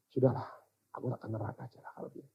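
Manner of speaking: very soft, low, quick surrender; quiet resignation. Retold or imitated. Preacher retells choosing hell over facing God, quietly resigned. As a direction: mumble it low and give up. Retold giving up before God.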